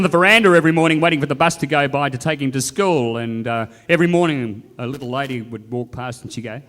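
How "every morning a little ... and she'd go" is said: ordinary talk, nothing marked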